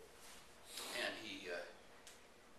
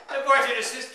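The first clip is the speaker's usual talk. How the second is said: normal talking voice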